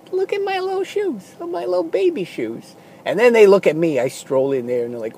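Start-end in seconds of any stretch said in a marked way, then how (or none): 0.1-2.8 much higher, softer cooing over little shoes; baby-talk their own shoes; tiny men cooing. Pretend. Little guys in the shoe aisle cooing over their baby shoes.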